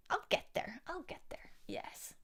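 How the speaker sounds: breathy whispered reassurance; whispering. Real, not acted. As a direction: whisper it to yourself.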